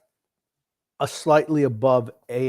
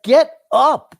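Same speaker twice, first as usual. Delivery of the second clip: loud, high exasperated yell; yelling at screen; 13 semitones higher, 11 dB louder than usual. Retold or imitated. Fan reliving yelling at a fallen goalie, exasperated shout. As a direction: yell it in two hard, separate bursts. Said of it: "I was yelling at the screen".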